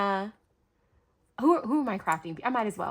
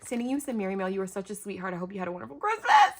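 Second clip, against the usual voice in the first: usual speaking voice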